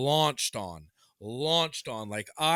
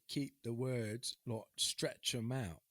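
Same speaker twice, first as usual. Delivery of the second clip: slow, soft, drawn-out coaching; stretched-out words; 4 semitones lower, 8 dB quieter, slower than usual. Shown as a demonstration. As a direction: demonstrate stretching the words, low and quiet. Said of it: "stretch 'em out".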